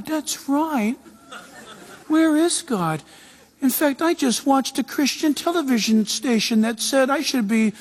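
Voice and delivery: high, whiny self-pity; high whiny doubter; doubting believer. Acted. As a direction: complain like someone who feels abandoned. Voicing a doubting, complaining believer.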